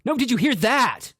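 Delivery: high, loud angry retort; angry snap. A narrator's reading. Grant snapping at Cassidy, high and loud. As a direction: bite back, furious. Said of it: "Grant snapped back angrily".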